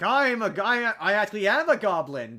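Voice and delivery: loud, high mock villain reveal; goblin leader reveal. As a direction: announce it like a hammed-up baddie. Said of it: "he's like".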